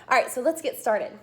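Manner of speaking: ordinary delivery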